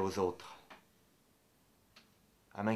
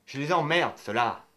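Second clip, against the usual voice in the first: contemptuous outburst; 3 semitones higher, 5 dB louder than usual. Acted. Ranting at happy people.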